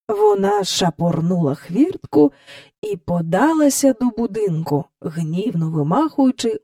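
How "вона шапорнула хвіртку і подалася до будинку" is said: ordinary delivery, nothing marked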